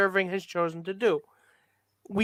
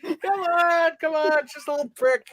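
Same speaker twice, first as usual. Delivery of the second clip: coaxing; 6 semitones higher than usual. Pretend. Mock coaxing Kyrie to vaccine.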